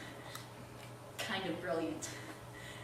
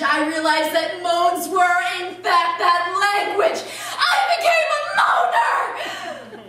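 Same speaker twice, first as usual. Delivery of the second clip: loud and slow, building to a high shout; triumphant proclamation; 7 semitones higher, 10 dB louder, slower than usual. Acted. Woman announcing what she became, exultant shout. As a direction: declare it grandly, climaxing in a drawn-out cry. Triumphant climax of her revelation.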